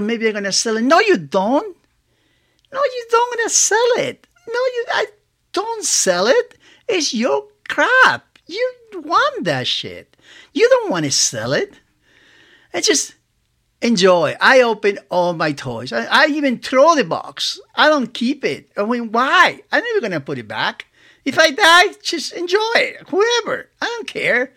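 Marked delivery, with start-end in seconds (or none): 2.7-11.8 much higher, insistent inner voice telling him off, slowing down at the end; scolding inner voice. Acted. Collector's inner voice scolding him about selling, high and insistent. 21.2-24.5 carefree outburst. Real, not acted. Telling heirs to enjoy the toys.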